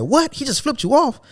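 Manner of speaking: mock shocked manager. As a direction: imitate the manager's astonished question. Manager's shocked reaction retold.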